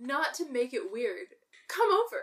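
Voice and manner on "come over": high-pitched joking come-on; mock flirting. Co-host jokes to her actor crush, high mock flirting. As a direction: toss out a playful invitation.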